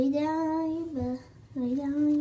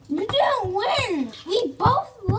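indignant protest; 6 semitones higher, 7 dB louder, slower than usual. Real, not acted. Arguing over who won.

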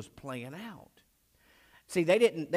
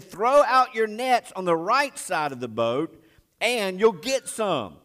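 raised, high-pitched shouted instruction; calling out; 6 semitones higher, 7 dB louder, slower than usual. Acted. Jesus telling the fishermen where to cast nets, calling out. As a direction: project it across the water, loud and high.

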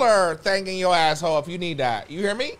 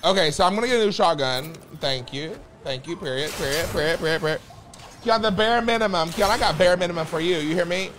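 ordinary talk

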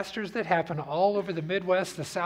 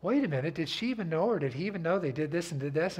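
usual speaking voice

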